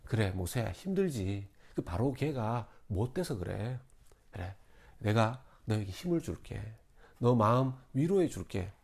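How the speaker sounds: soft, low, reassuring; gentle consoling voice; comforting God. Acted. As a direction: pat him on the back with words. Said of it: "다독이시면서".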